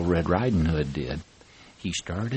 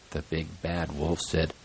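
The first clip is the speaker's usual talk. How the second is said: usual speaking voice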